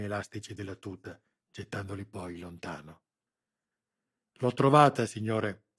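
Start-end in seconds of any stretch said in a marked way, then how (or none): 4.4-5.7 loud, eager report to the boss; exclaiming. A narrator's reading. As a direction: call out the find proudly. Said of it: "esclamò l'agente".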